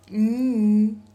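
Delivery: N as a long, loud hum; isolated consonant sound. Shown as a demonstration. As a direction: hum out N alone, no vowel. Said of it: "I am going to pronounce".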